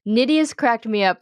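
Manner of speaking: ordinary talk, nothing marked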